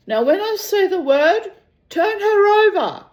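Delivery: high, loud, projected order; commanding captain. Acted. Captain in cockpit tells mechanic to start, calling out. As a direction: call the instruction out to the crew.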